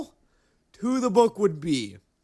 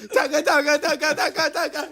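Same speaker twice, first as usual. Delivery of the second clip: laughing gunfire; 8 semitones higher than usual. Acted.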